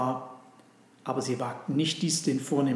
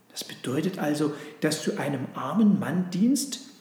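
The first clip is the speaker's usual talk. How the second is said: ordinary delivery, nothing marked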